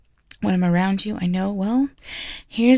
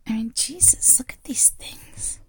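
whispering; 5 semitones higher, 4 dB quieter than usual. Acted. Teacher confiding in her aide, hushed whisper.

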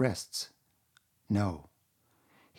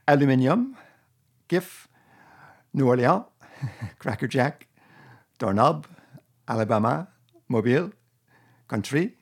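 put-on amateur Francophone Canadian accent, higher and louder; a hammed-up Québécois accent, higher and louder; 4 semitones higher, 7 dB louder than usual. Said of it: "my attempt to do, uh, Francophone Canadian accent".

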